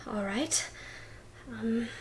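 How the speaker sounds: hushed unvoiced whisper. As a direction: breathe it out in a whisper. [name] answers whispering.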